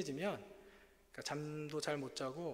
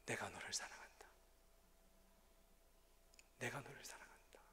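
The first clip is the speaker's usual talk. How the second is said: lower, unvoiced loving whisper; God's whisper; 4 semitones lower, 13 dB quieter than usual. God answering the sick pastor, lower hushed whisper. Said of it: "하나님 말씀하셔요".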